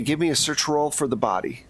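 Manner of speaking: ordinary delivery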